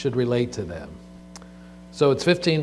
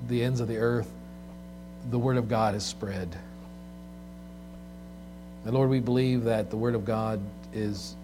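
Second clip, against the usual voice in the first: prayer; 2 semitones lower, 7 dB quieter than usual. Acted. Pastor leads congregational prayer.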